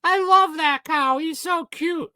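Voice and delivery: put-on high, cooing little-girl voice. Mimicking his little sister cooing over farm animals. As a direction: gush like a small child over animals. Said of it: "that's my wife now".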